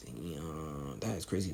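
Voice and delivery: low, very soft stunned reaction; hushed amazement. Retold or imitated. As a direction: drop right down in quiet disbelief. Reacting amazed to the retold scene.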